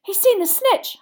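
high, urgent cry; excited shout. A narrator's reading. Boy sees the seeker dive, excited shout. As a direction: shout it as news to friends. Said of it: "Harry shouted".

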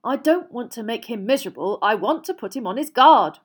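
mildly heated comeback; retorting. A narrator's reading. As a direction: argue back firmly. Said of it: "retorted Mister Weasley".

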